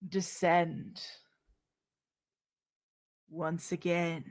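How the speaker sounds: slow, grand announcer voice for the break; dramatic host sign-off. Acted. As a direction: build up the drama as you descend.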